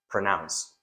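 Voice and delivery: "Pronounce" with a short, reduced first syllable; correct pronunciation. Shown as a demonstration. Teacher modelling the word for students, "Pronounce" with a light first syllable. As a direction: say "Pronounce" low and soft, first syllable unstressed. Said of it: "how do you actually pronounce it".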